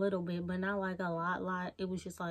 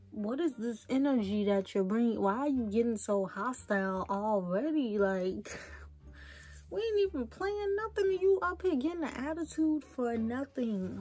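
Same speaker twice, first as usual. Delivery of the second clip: indignant retort. Retold or imitated. Woman retelling her retort to a hostile caller, indignant. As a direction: call out his attitude in mock outrage.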